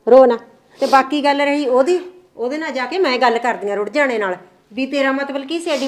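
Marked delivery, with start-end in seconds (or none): 0.1-0.4 louder, brisk telling-off to stop crying; scolding the crying girl loudly. Acted. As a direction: snap her out of her tears. Mother scolds crying daughter-in-law.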